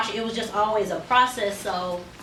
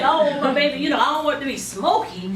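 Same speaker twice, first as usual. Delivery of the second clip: higher, louder, laughing through a mock objection; laughing refusal; 5 semitones higher, 5 dB louder than usual. Stylist recalling her refusal to the old-school lady, laughing. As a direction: laugh as you push back on the idea. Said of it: "And I'm like".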